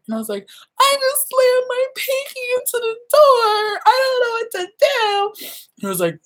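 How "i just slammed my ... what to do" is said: high, tearful whine; mock sobbing about a stubbed pinky; whiny crying. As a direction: whimper it like a hurt kid. Retelling her pinky injury.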